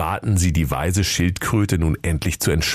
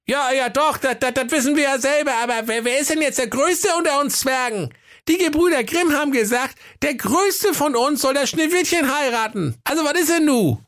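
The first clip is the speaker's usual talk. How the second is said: very high, fast little dwarf voice; impatient dwarf Klaas. Acted. Dwarf Klaas demands the tortoise's ruling, squeaky and impatient. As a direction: squeak it out, hurried and insistent.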